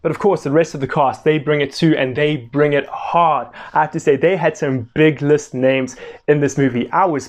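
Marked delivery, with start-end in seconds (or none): none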